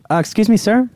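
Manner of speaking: sweet service voice. Acted. Attendant calls politely to passenger.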